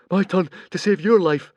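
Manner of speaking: gasping. A narrator's reading. Fishlegs gasping to Hiccup after rescue, breathless. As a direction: gasp it out after surfacing. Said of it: "gasped Fishlegs".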